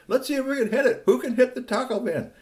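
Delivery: high, gleeful squirrel voice; playful ninja squirrels. Acted. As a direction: egg each other on in high squirrel voices. Squirrels playing a dropping game.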